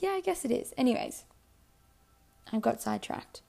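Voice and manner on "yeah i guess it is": higher-pitched retold concession; conceding reply. Speaker answering her shocked friend, lightly conceding. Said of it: "And I was like".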